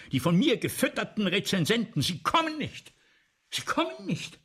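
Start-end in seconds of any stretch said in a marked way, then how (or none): 2.0-4.4 much higher, rising alarm; a director's panicked cry. Acted. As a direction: panic as it sinks in. Director panics, audience absent.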